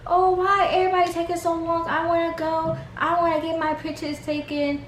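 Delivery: high, sing-song mock whine; whiny big baby. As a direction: imitate an impatient big baby whining. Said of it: "a big baby about it".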